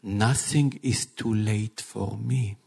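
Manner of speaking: calm, loving voice of Jesus speaking to his bride; gentle reassuring Jesus. Acted. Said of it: "what the king told Jairus?".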